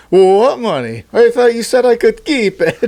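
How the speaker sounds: high, loud put-on foreign accent; playing Zelensky in a silly accent. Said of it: "whatever the fucking accent is".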